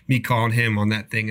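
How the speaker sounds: usual speaking voice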